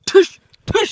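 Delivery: short imitation gun pops; mouthed gunshots. Hero shoots rival in pretend fight, mouthed bangs. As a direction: fire a pretend pistol with your mouth.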